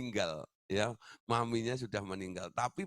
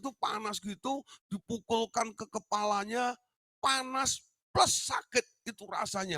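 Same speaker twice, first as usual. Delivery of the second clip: higher, strained voice of the hurt son, slowed on the opening cry; pained client's voice; 10 semitones higher, 3 dB louder than usual. Retold or imitated. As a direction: relive the burn and the tears as the wounded young man. Retelling the client's painful memory.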